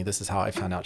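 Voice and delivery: usual speaking voice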